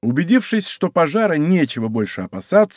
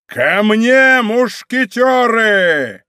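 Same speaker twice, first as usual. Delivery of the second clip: high, loud, long-drawn rallying call; rally them, stretching the last word; thunderous battle cry; 6 semitones higher, 4 dB louder, much slower than usual. A narrator's reading. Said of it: "крикнул громовым голосом".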